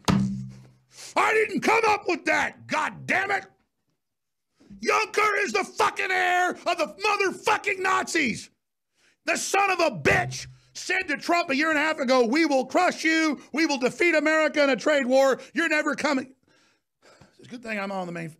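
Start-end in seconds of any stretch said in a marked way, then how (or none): 0.1-12.3 furious high shouting with curses; swearing tirade. Real, not acted. 12.3-16.3 shouted threat. Retold or imitated. Quotes Juncker mid-rant.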